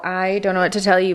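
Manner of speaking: ordinary talk, nothing marked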